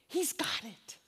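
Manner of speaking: hushed whisper. Real, not acted. Preacher whispering comfort to the congregation.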